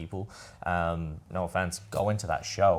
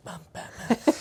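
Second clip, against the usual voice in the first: whispered, much higher mock Batman line; 3 dB louder, slower than usual. Joking about the Batman actor's girlfriend, whispered Batman voice. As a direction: toss off a whispered Batman impression. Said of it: "it was also Batman at Dreamworld".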